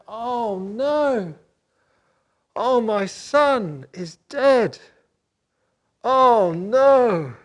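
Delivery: loud, high, very slow lament; heartbroken wailing. Acted. Jacob learning his son is dead, wailing in grief. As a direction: wail for a lost son. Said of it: "And he was heartbroken".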